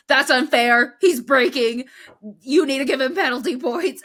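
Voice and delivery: short angry radio demand for a penalty; Max on the radio. Retold or imitated. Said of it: "he's like".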